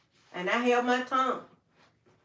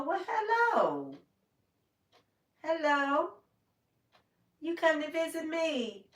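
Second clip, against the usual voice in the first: tender greeting to bird; 7 semitones higher, slower than usual. Real, not acted. Greets bird at her windowsill.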